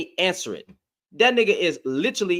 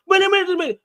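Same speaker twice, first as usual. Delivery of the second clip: pitched way up to stop the argument; urgent interrupting; 10 semitones higher, much faster than usual. Real, not acted. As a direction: jump in high to cut him off. Cutting in on a shouting opponent.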